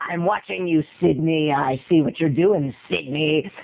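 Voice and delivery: low, creepy watcher on the phone; deep stalker-caller voice; audition villain voice. Shown as a demonstration. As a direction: menace her in a deep voice. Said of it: "I did like a thousand ways".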